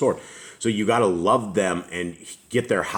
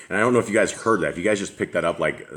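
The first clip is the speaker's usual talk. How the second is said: ordinary talk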